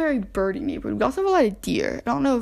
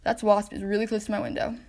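usual speaking voice

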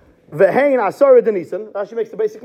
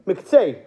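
Miktzei set apart and weighted, falling at the end; stress on Miktzei. Shown as a demonstration. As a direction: lean hard on the word Miktzei. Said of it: "emphasis on the word Miktzei".

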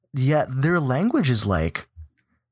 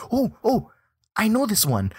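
much higher, giddy squeal of recognition; excited recognition; 12 semitones higher than usual. Retold or imitated. Player retelling seeing a familiar name in the game, excited. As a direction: act out spotting a name you know.

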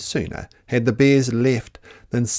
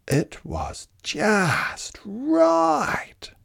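very slow, savouring just right; slow and pleased; 10 semitones higher, much slower than usual. A narrator's reading. Narrator reads to children, slow and satisfied. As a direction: draw out the happy ending of the list.

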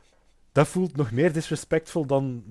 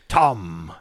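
pirate voice; 5 semitones lower, 5 dB louder than usual. Pretend. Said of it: "met een piraatstem".

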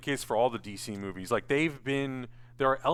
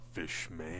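lower, softer, tough-guy quote; deep gruff line; 9 semitones lower, 12 dB quieter than usual. Retold or imitated. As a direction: growl it like a hulking hero. Quoting Momoa's gruff reply.

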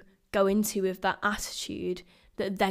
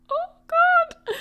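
high squeaky burst of retold panic; a sudden nervous cry of dread; panicked yelp; 9 dB louder than usual. Her nerves hitting as she faces the circle, high panicked yelp. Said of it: "I was so nervous".

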